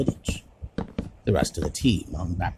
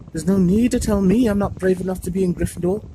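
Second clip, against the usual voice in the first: higher, louder, close to tears; choked up; 8 semitones higher, 6 dB louder than usual. A narrator's reading. Neville to his friends, mildly choked up. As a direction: fight back tears on the words. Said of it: "Neville choked".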